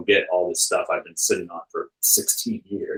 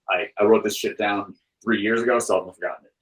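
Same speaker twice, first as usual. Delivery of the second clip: ordinary talk, nothing marked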